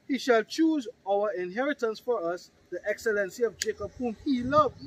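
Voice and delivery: loud, forceful Bible reading; scripture reading. Acted. Preacher reading of God's chosen inheritance, forceful declamation. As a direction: declaim it to the room.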